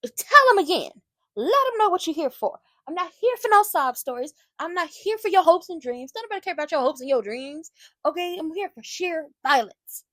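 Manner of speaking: loud, high hype; cheering on Shiloh's promo, loud and high; hyped shouting. Real, not acted. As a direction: egg him on like a fan. Hyping the promo herself.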